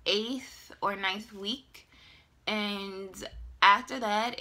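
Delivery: ordinary talk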